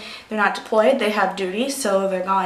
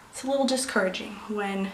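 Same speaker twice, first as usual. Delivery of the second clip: soft real whisper, holding back tears; emotional whisper; 9 dB quieter than usual. Real, not acted. Military wife on lacking support, whispering near tears. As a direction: let it drop to a tearful whisper. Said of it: "I'm gonna start crying now".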